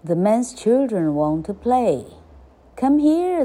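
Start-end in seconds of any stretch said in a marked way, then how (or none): 2.8-3.5 high, louder playful call. A narrator's reading. Children to the duckling, calling out. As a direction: call someone over.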